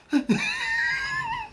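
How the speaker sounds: a high shrieked burst of laughter; high shrieking laugh. Real, not acted. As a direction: crack up in a squealing laugh. Laughs at own story.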